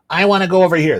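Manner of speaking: a hint of a stubborn little girl; defiant daughter.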